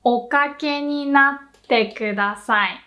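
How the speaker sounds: slow, even Okake ni natte kudasai; slow model phrase. Shown as a demonstration. Teacher modelling the polite phrase slowly. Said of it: "You will also hear".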